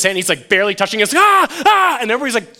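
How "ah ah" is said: high, loud, short shrieks; mock little-girl shriek. Lineman touching the mystery box, mock girlish shrieks. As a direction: imitate a squeamish big man shrieking. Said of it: "shrieking like a little girl".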